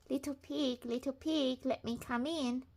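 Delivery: polite wolf pleading softly; wolf asking. A narrator's reading. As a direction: ask sweetly at the door like a sniffly wolf. Wolf voiced in story.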